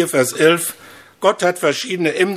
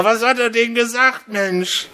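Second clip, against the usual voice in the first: higher shouted question out the window; mock shout; 8 semitones higher than usual. Hard-of-hearing wife shouts from the car window, mock shout. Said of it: "schreit die Frau wieder aus dem Fenster".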